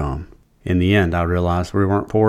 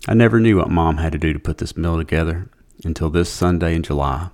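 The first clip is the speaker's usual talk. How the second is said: usual speaking voice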